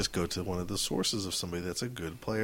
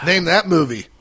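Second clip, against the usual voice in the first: loud; 6 semitones higher, 10 dB louder than usual. Real, not acted. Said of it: "quiz call to co-host".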